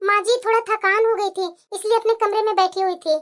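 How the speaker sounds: tired daughter-in-law answering politely. Acted. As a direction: answer your mother-in-law meekly.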